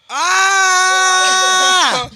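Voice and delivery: sudden loud cry of pain; pained shout. Real, not acted. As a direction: yelp as the heat hits. Hot sauce pain cry.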